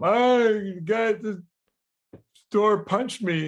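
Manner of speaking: put-on high whiny kid voice, mushy words; a little kid wailing to mom; kid with speech impediment. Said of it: "The kid runs home crying".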